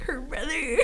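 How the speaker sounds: high, small, choked-up imitation; mock tearful. Retelling getting emotional over the book, high mock-tearful voice. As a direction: squeak it out as if moved.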